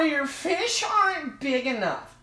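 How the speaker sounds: high, slow mock-critic voice; mocking online commenter. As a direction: drag it out in a silly high whine. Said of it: "and I simply get".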